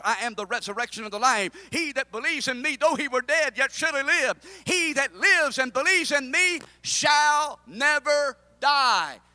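loud, high fervent preaching; preaching at full force. Acted. Preacher to congregation, loud fervent preaching. Preacher quoting Jesus loudly.

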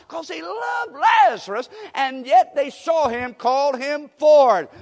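high, loud, slowing preaching shout; preaching climax. Acted. Preacher telling of Lazarus at the tomb, shouting. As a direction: shout it out to the rafters.

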